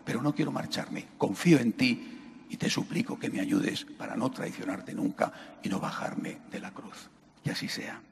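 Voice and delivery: soft, trailing into a whisper; hushed closing amen; fading prayer. Acted. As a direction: let the prayer die away.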